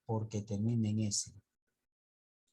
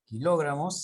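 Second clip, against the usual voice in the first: "kilogramos" with a higher, louder LO; stress on LO; 4 semitones higher, 7 dB louder than usual. Shown as a demonstration. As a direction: punch the second syllable, lo, in "kilogramos". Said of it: "tendría que llevar tilde porque sería una esdrújula".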